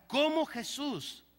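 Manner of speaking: ordinary delivery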